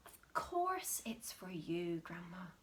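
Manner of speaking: sweetly sly. Acted. Mister Cranky sweetly offering Grandma the cup. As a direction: purr it sweetly. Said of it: "smiled sweetly".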